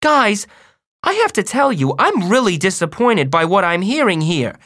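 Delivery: high, emphatic disappointed mother. A narrator's reading. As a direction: lecture your boys in dismay. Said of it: "she looked like how she sounded".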